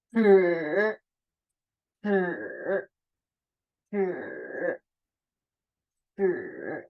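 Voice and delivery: Ber as a retroflex zhi, tongue curled right back; tongue rolled back. Shown as a demonstration. Teacher showing tongue position, strongly retroflex Ber. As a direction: curl the tongue back and hold Ber as a buzzy r-coloured vowel. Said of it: "lidahnya benar-benar kalian gulung ya ke belakang".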